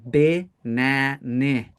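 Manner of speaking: BANANA very slowly as bih-NAN-ih; wrong vowels. Shown as a demonstration. Teacher showing what cannot be said, slow wrong vowels. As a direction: split BANANA into syllables, short i first and last. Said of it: "like with an I".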